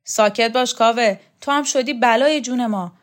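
loud, scolding yell at Kaveh; shouting. Acted. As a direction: shut him up with a bark. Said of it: "قهرمان شوکت داد کشید".

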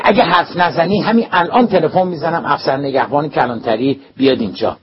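loud, high threatening shout; yelling threat. A narrator's reading. Vice principal yelling a threat to call the police. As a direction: yell the threat at him.

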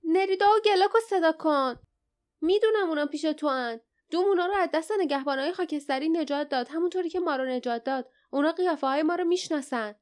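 high-pitched, heated demand; quick, indignant acted outburst; angry shout. A narrator's reading. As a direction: protest angrily, pitch up and words rushing. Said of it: "جاسمین با عصبانیت فریاد زد".

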